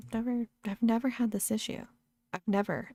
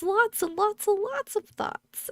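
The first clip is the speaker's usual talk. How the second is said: giddy; 14 semitones higher, 5 dB louder, slower than usual. Retold or imitated. Describing racing high.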